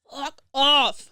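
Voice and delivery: a loud, exasperated fuck off; fuck off lancé. Real, not acted. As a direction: snap it out, fed up. Ras-le-bol envers les commentaires. (fuck off lancé fort, exaspéré)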